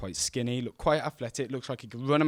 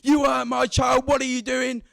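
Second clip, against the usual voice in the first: much higher, shouted accusation; vile angry mum; 12 semitones higher, 4 dB louder than usual. Retold or imitated. Angry mother shouting at the boy who hurt her child, high and loud. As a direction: lay into him like a furious mother. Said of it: "pure vileness spills out".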